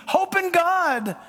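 high, slow, drawn out; lifted reading. Acted. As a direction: lift the words up as a plea.

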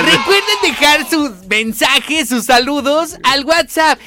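high, slow, squeaky clown voice; bright squeaky sing-song welcome. Acted. Host clown-like promo read.

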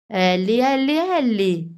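Alice shouts at Ellie, annoyed; shouting. A narrator's reading. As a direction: call her out sharply. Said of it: "gridò Alice".

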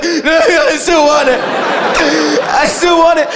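pleading through laughter; whimpering kid. Retold or imitated. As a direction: whine it like a hooked kid. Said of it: "He was like".

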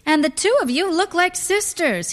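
high-pitched flirty compliment; flirtatious flattery.

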